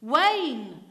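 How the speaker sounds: put-on a stubborn boy shouting his answer back; stubborn repeat. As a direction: throw the name straight back. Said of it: "repeated the child".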